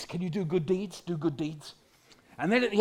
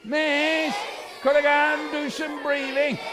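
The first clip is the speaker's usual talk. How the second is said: high, loud drawn-out whine; exaggerated child's wail; whiny kid; 6 semitones higher, 4 dB louder than usual. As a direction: whinge up high like a pleading pupil. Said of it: "The whiny kid".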